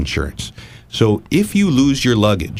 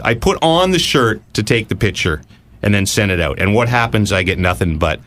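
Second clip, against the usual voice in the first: usual speaking voice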